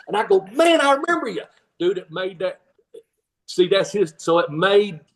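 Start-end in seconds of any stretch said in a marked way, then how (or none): 0.5-1.4 high, hammed-up delight; mock recognition. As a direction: pretend to suddenly remember him. Playing along with fan.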